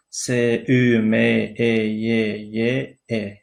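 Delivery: letters recited one by one in an even tone; spelled letter by letter. Shown as a demonstration. Teacher spells Sümeyye for learners, letter by letter. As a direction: sound out each letter of the name separately. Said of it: "اما حرفکی".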